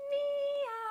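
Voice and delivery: loud high drawn-out meow; a big stretched cat meow. Shown as a demonstration. Teacher demonstrating the meow face stretch, loud and high. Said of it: "Number five on our list is".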